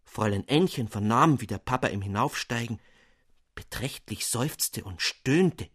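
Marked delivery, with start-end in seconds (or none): none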